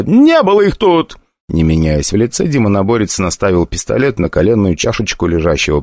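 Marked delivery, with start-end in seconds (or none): 0.0-1.2 much higher, louder tearful protest; tearful cry. Acted. The summoner to Stronger, higher, louder and tearful. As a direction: cry out the denial in tears. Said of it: "по его щеке скатилась слеза".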